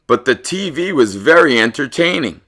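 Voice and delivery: ordinary delivery, nothing marked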